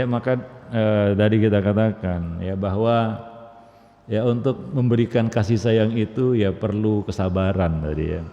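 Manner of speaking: ordinary delivery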